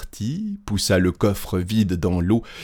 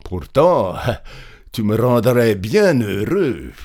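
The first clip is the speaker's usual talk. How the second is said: mildly pleading, pushed louder; insisting; 3 semitones higher, 4 dB louder than usual. A narrator's reading. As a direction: coax with a touch of pressure. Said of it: "dit le paysan en insistant". (avec une légère insistance)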